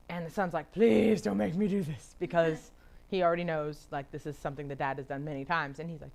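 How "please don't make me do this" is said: put-on slow, drawn-out teenage plea; mock pleading of a reluctant son. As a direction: beg reluctantly, stretching the "please". Acted son pleading with dad.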